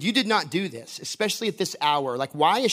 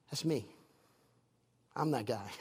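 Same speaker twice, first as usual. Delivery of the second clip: much softer, lower, offhand; understated reveal; 6 semitones lower, 12 dB quieter than usual. Acted. Jesus revealing himself to the woman, quiet understated reveal. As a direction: drop it quietly, almost thrown away.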